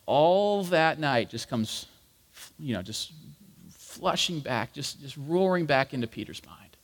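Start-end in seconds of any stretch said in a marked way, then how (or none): none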